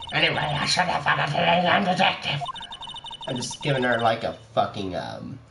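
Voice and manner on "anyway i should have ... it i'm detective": ordinary talk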